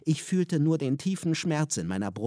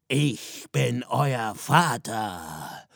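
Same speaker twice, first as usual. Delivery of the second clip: slow, loud and commanding, every word weighed; booming father reveal; 4 dB louder, much slower than usual. A narrator's reading. Father reveals himself to his children, slow and booming. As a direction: announce it slowly like a looming father. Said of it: "Der Mann rief".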